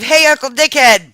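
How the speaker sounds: high, loud sing-song greeting; parrot-teaching voice. Pretend. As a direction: say it like coaching a parrot.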